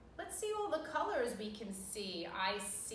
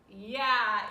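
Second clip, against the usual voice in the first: usual speaking voice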